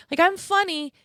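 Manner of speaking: high, loud sassy insistence; mock indignant. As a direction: play it offended but joking. Mock indignant about being funny.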